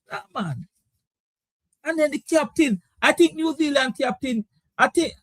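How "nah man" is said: muttered dismissal. Real, not acted. Host repeating his verdict on New Zealand, softly muttered. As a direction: let "Nah, man" fall away under your breath.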